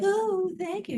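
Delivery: high, gushing, showy thank-you; mock gushing thanks. Shown as a demonstration. Leader mocking a hollow thank-you to the group. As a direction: throw out an overdone polite thanks. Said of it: "You're not like".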